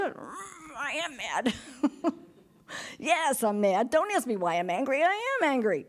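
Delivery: mock childish tantrum; angry little kid. Imitating grandkids insisting they are mad, playful tantrum. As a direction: pout and protest like a cross grandchild. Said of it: "Like little kids, you know, greys and grandkids".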